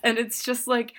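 ordinary talk

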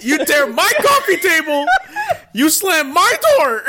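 high, loud complaint breaking into laughs; laughing mock rant. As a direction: rant as the father through laughter. Acting Yujiro's complaint, laughing.

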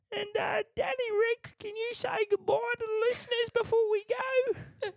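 pub bloke playing host; chatty bloke down the pub, signing off. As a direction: put on a pub regular's voice. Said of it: "I do the bloke down the pub".

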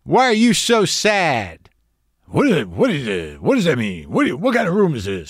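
mock-baffled Rodney, lightly voiced; a hint of a confused, shaken comic; rattled Rodney Dangerfield. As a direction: thrown off balance, sputtering the questions. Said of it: "shook him up".

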